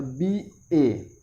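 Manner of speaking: letters spelled very slowly; slow spelling. Shown as a demonstration. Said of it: "बी ए उच्चारण व्यंजन".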